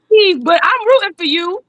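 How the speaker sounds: high-pitched, sing-out encouragement; playful cheering. Acted. Repeats the cheering voice.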